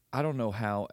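normal talking voice

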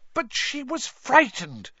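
high, slow, indignant protest; angry outburst. A narrator's reading. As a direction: burst out in an indignant girl's high voice. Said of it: "Hermione burst out angrily".

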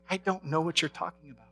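softly voiced, imitating a gentle whisper; hushed retelling of God's reply; retold whisper. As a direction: drop well below talking level, still voiced. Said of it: "I remember hearing his whisper".

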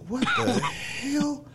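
stunned disbelief. Retold or imitated. Finds the panties and heels.

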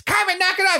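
high, squeaked mock cartoon kid; squeaky kid voice. Kyle scolding Cartman in a high mock kid voice. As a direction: squeal it like an annoyed cartoon boy. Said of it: "like, the kid voices".

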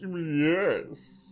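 lightly pompous British yes; a hint of posh Brit; British accent. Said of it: "like as if they were British".

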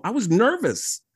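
usual speaking voice

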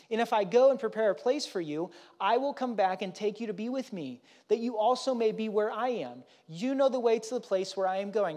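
reading scripture aloud from the pulpit. Acted. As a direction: proclaim the verses to the congregation. Reads Jesus's words aloud.

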